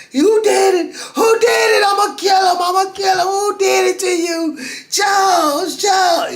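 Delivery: high, loud crying scream. Retold or imitated. Friend demanding who hurt him, high crying scream. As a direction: scream for revenge, voice breaking.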